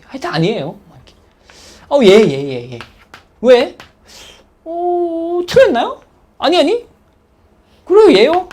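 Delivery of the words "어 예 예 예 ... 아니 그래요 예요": higher, louder flustered back-and-forth; a hammed-up nervous student; flustered student dialogue. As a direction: play both voices of a confused exchange. Acted teacher–student exchange.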